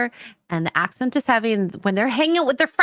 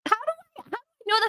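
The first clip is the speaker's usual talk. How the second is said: indignant squeak; 13 semitones higher, 4 dB quieter than usual. Real, not acted. S0 protesting in disbelief.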